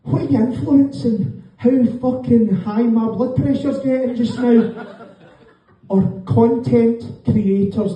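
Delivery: ordinary talk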